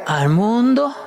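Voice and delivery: mock astonished ¿Al mundo?; incredulous echo. Shown as a demonstration. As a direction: throw the question back in disbelief. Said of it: "Al hombre".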